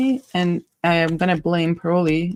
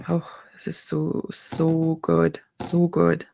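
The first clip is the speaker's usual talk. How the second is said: savouring murmur; 4 dB quieter, slower than usual. Real, not acted. Savouring the scent, soft.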